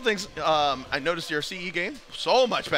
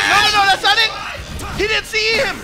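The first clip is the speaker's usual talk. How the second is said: shout; 13 semitones higher, 6 dB louder than usual. Real, not acted. Caster reacting to a missed combo.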